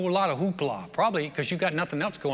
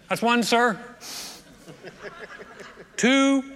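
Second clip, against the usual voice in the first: calling the count; 5 semitones higher, slower than usual. Acted. Servant counting his master's dips aloud, calling out. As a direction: call each number up and out.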